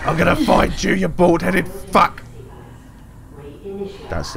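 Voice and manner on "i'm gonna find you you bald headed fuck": furious shouting. Real, not acted. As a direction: scream vengeance at him. Raging at the kidnapper.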